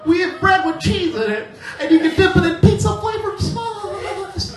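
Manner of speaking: very high, eager upsell voice; pizza phone kid. Retold or imitated. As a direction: chirp it like a teenage phone clerk pushing extras. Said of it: "This kid's on the phone".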